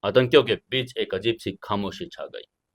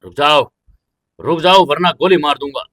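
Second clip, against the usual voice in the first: loud, higher-pitched shouted command; shouted threat; 4 semitones higher, 8 dB louder than usual. A narrator's reading. Militant guard to the approaching runner, shouted threat. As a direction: shout it as an armed warning to halt. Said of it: "चिल्लाकर कहा".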